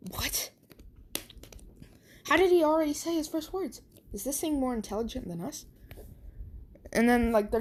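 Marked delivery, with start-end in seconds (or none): 0.1-5.7 high, fast, shocked doctor; quick alarmed doctor's voice. Retold or imitated. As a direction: rattle it off in disbelief. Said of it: "the doctors are like".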